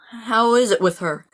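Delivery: ordinary talk, nothing marked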